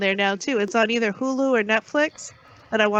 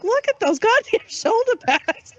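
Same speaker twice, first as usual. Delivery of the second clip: slow, high, laughing disbelief; amused disbelief; 10 semitones higher, slower than usual. Retold or imitated. Watching an old movie with partner, slow amused disbelief. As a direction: marvel at the silliness, slowly. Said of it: "And I was like".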